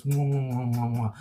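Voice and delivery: kiss sounds mwah; blowing kisses. Shown as a demonstration. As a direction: blow a string of air kisses. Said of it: "Blowing kisses".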